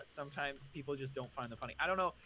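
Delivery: ordinary delivery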